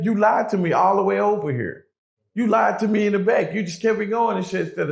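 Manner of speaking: furious telling off; angry accusation. Retold or imitated. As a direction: throw the lie back in his face. Angry address to absent man.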